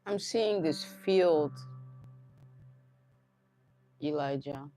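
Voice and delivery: slow, measured, dreamy description; unhurried, trance-like telling with long pauses; slow vision-telling. Real, not acted. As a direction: describe the vision slowly, phrase by phrase. Describes visions for a listener's song.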